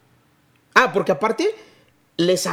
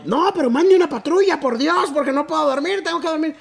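vecino quejumbroso con voz aguda; modito golpeado; 10 semitones higher than usual. Retold or imitated. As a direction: pide la patrulla con tono exigente. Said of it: "tenía moditos golpeados". (el vecino pidiendo patrulla, con modito golpeado)

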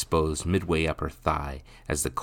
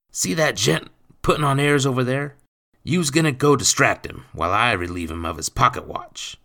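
loud, raised rough-spoken voice; scheming stepfather; 9 semitones higher, 7 dB louder than usual. A narrator's reading. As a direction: set out the theft insistently. Stepfather gives the plan.

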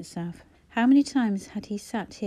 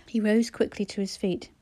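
ordinary delivery

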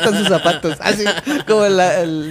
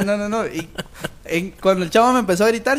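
ordinary talk

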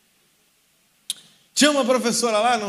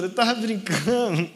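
laughing; slower than usual. Retold or imitated. Retold excuse to the feared teacher.

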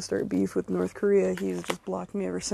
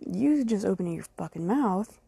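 normal talking voice

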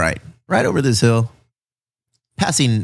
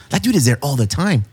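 normal talking voice

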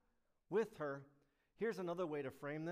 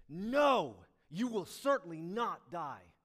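luid, nadrukkelijk ontkennen; emphatic serpent; 2 semitones higher, 14 dB louder, slower than usual. Acted. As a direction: roep het stellig uit als de slang. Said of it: "He's emphatic".

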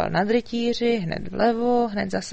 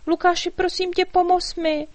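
high, loud fairy voice calling for help; desperate fairy plea; 10 semitones higher, 5 dB louder than usual. A narrator's reading. Tiny fairy begging the dragon to help, high pleading call. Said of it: "volala zoufale".

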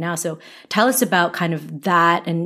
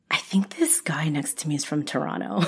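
breathy whisper of a private hunch; whispered thought; 2 semitones lower, 7 dB quieter than usual. Retold or imitated. Recalling her hunch about the stranger, whispered. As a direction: whisper it like a secret guess.

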